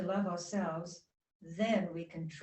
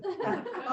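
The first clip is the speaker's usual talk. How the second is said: giggle; 13 semitones higher, 3 dB louder than usual. Real, not acted. Laughs at own pet talk.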